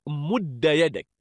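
مد said "mudda", short a after doubled d; fatha ending. Shown as a demonstration. Teacher giving students a parallel verb, مد as "mudda". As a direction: end مد on "-da". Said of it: "جعله بمنزلة".

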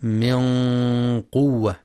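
من قوة with a held, dark nasal n; من قوة with hidden n, long heavy nasal hum; heavy ghunnah. Shown as a demonstration. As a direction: hum the n of من heavily into the q. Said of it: "مع تفخيم الغنة".

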